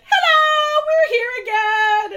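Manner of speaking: high, drawn-out mock greeting shout; retold loud holler. Podcaster voicing hooligans lit up in cars, high loud holler.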